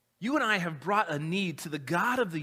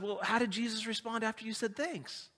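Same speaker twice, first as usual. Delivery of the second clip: a slightly higher, softer probing wife; pointed wife; 4 semitones higher, 4 dB quieter than usual. Retold or imitated. Wife quizzes her husband about thanking Jesus, lightly pointed. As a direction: ask it like a wife who already suspects. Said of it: "And she said".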